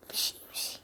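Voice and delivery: urgent whisper. Real, not acted. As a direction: hiss it out under your breath. Hushed urging in game.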